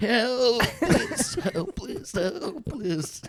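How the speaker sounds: high, laughing cries for help; giggling fake plea for help; laughing mock cry for help. Esteban act, laughing cries.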